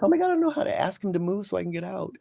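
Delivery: high, fast retold panic; panicked. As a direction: imitate her rushed alarm, lightly. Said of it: "she panicked because she goes".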